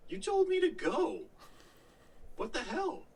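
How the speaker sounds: high, loud indignant yell at the game; indignant outburst. Retold or imitated. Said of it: "I'm like".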